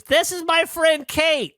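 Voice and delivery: very high, slow, hollered introduction; exaggerated high-pitched loud woman impression; loud American lady. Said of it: "except they're very loud".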